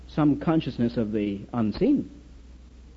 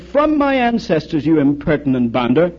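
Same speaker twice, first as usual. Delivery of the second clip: losing patience; 4 dB louder than usual. Retold or imitated. English duke answering the Irishman, losing patience. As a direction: act out mounting exasperation. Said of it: "The duke was losing his patience".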